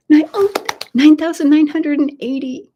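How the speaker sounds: high, loud call of the number; excited count. Real, not acted. Host reading the new count to viewers, excited. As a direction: announce it with real thrill.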